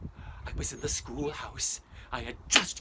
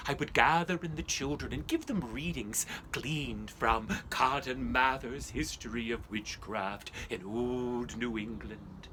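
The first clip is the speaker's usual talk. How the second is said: ordinary talk, nothing marked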